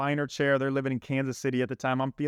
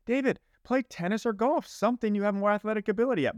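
imitating parents; 7 semitones higher, faster than usual. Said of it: "my parents probably should have said".

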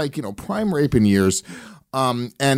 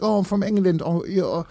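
higher-pitched put-on British accent; fake British accent; 7 semitones higher than usual. Girl at the bar introduces herself to him, fake British accent. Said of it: "faked the British accent".

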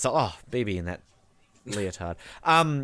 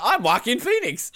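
laughing. Retold or imitated. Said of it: "I'm like".

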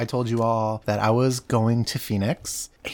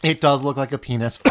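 ordinary talk, nothing marked

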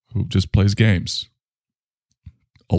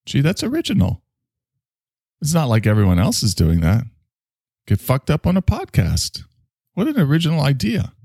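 sarcastic; 7 semitones higher than usual. Real, not acted. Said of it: "sarcastic comment on podcasts".

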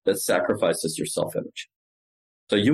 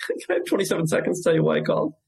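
high, quick question with a laugh in it; playful and curious; 8 semitones higher, faster than usual. Shown as a demonstration. Cold caller inviting Doug along, playful and curious. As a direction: switch to a light come-along-with-me voice. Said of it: "in a playful and curious voice".

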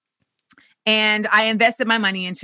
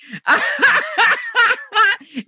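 squealing laugh, high up; high laughing fit; 13 semitones higher than usual. Real, not acted. Host cracks up at flex.

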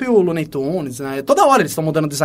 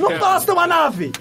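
high, put-on little girl complaint; mock a pouting little girl; whiny girl voice; 9 semitones higher than usual. Repeats line as whiny girl.

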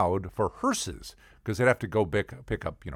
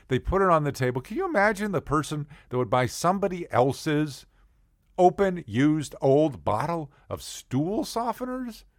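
usual speaking voice

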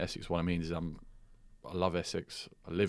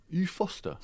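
high, surprised bloke voice; retold bloke voice; 11 semitones higher, slower than usual. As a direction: ask it up high like a startled stranger. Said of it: "And he went".

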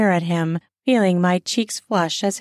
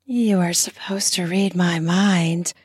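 sultry voice; 2 semitones lower than usual. Acted. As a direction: tease him with a sultry tone. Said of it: "I give him my best sultry voice".

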